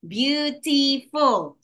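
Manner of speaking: "Beautiful" slowly in three separate beats; syllable by syllable. Shown as a demonstration. Teacher giving three-syllable example, "Beautiful" slowly by syllables. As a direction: stretch "Beautiful" out, one syllable at a time. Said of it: "Three syllables".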